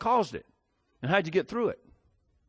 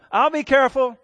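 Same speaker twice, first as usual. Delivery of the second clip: put-on child voice; 9 semitones higher, 9 dB louder than usual. Child answering mother in story.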